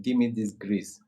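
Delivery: usual speaking voice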